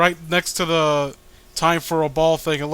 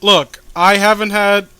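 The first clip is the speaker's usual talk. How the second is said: voiced character; 3 semitones higher, 8 dB louder than usual. Reading character dialogue in voice.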